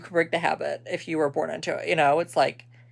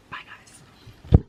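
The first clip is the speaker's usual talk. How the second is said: whispered goodbye; 3 dB louder than usual. Real, not acted. Vlog sign-off.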